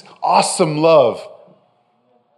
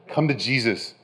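soft invitation; 4 semitones lower, 8 dB quieter than usual. Acted. Preacher's soft invitation, repeated.

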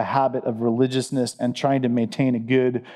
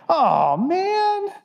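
mock dismay; 13 semitones higher than usual. Mock dismay losing Father's Day.

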